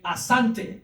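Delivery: "Asante" said a-SAN-te, rising at the end; Swahili thanks. Shown as a demonstration. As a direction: teach "Asante" as the reply. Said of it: "you say".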